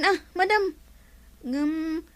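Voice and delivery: ordinary delivery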